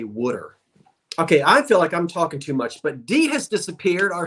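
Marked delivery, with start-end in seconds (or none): 0.0-0.6 "wooder" said the South Jersey way for water; "wooder", the regional form of water; South Jersey pronunciation. Shown as a demonstration. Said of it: "I cannot not say wooder".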